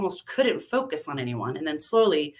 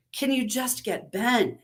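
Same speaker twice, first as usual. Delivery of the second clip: husky voice; 6 semitones higher, slower than usual. A narrator's reading. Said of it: "sounding like a husky version of herself".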